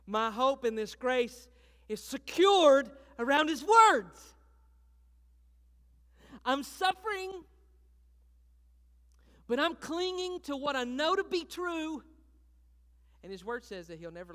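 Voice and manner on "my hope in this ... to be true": loud, slow, high testimony; cling to it out loud; full-force preaching. Acted.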